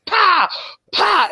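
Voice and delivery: high, sharp mock whip crack sounds; whip crack imitation. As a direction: smack it out like a whip lash. Whipping sound imitated in story.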